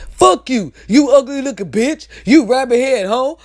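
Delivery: imitating a rejected guy cussing her out; mock angry cussing. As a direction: spit the insults like a sore loser. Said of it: "y'all wanna cuss us out".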